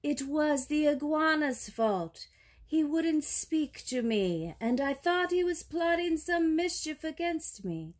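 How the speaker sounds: high, aggrieved python voice; python's protesting cry. A narrator's reading. Python blames the iguana before the king, high protesting cry. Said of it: "he cried".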